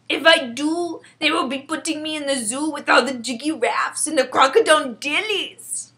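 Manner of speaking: loud, emphatic giant voice; worried friendly giant. Acted. BFG protests.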